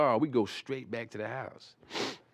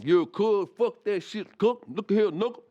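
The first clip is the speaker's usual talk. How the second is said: much higher, louder mock gangbanger; 10 semitones higher, 3 dB louder than usual. Comic mimics gangbanger in barbecue line, hammed-up street tough. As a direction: ham up a tough street hustler. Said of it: "starts going through the whole gangbanger".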